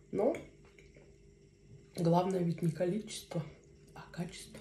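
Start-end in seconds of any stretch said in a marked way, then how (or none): 4.0-4.6 whispered. Real, not acted. Punchline dropped to a whisper.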